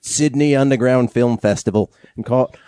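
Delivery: put-on reading the letter in a light Australian accent; a hint of Australian accent, read aloud. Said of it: "Do it in an Australian accent".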